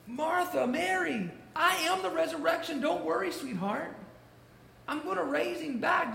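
comforting Jesus. Retold or imitated. Jesus comforting Martha and Mary at the tomb, tender. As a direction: soothe them like grieving children.

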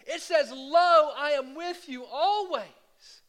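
high, loud, delighted cry; excited exclaim. Retold or imitated. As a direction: burst out with joyful discovery. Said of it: "he exclaimed".

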